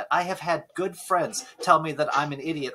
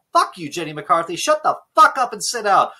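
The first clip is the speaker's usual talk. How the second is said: angry outburst; 6 semitones higher, 8 dB louder than usual. Real, not acted. Yelling at Jenny McCarthy.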